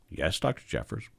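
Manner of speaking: small, soft obedient answer; acted timid trainee; meek reply. Trainee meekly answers stern surgeon.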